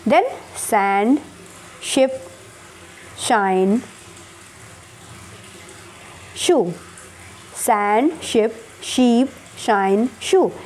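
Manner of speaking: सैंड with स, then शिप, शाइन, शू with श; odd-word drill. Shown as a demonstration. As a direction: read out the row carefully. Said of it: "ठीक है". (स और श अलग करके बोलना)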